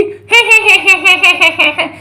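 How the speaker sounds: long rapid "hehehe" cackle; witch cackle. Pretend. Witch mocks the mother's demand, long cackle.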